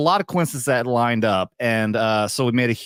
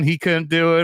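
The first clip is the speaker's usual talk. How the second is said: normal talking voice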